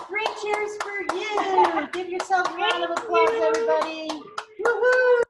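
loud, high celebratory cheering; rally the class into applause; rousing cheers. Real, not acted. Teacher leading applause for her painting class, loud rousing cheer.

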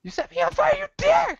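very high indignant shriek; shrieking outrage. Real, not acted. Shrieking accusation.

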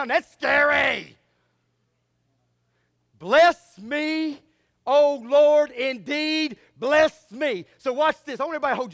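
loud building preacher cadence; rising fiery sermon; full-force preaching. Real, not acted.

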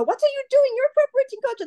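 mocking accusers. Mocking Americans accusing returning tourists, high loud whine. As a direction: mimic them shrilly to ridicule them. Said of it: "they go".

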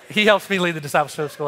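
usual speaking voice

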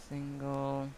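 ordinary talk, nothing marked

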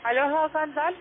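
ordinary talk

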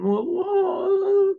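very high drawn-out wail; long mock sob soaring high; acted crying. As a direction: imitate a man wailing in grief. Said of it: "he's just crying and".

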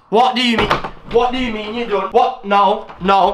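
can't believe he's finished already; high, disbelieving retort; outraged disbelief. Retold or imitated. Retorting to barber rushing cut.

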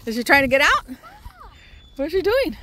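sudden high, loud alarm; startled cry as the dog slips; alarmed surprise. Real, not acted. Grandma startled as her dog tips in the stroller, high alarmed voice.

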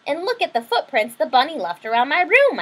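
a hammed-up grumble; complaining. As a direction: whine about the bunny's mess. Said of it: "I complained".

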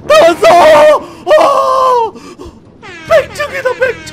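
high, loud shout of relief; excited shout. Real, not acted. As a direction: yell out in triumph. Reaches floor 100.

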